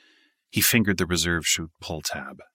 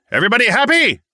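loud yell, much higher; 9 dB louder, faster than usual. A narrator's reading. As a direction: yell it over engine noise. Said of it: "The jump master yelled".

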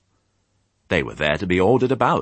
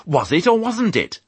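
much higher, louder exasperated demand; impatient bark; 13 semitones higher, 5 dB louder than usual. A narrator's reading. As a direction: snap it like an impatient sheepdog. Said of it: "barked Fly".

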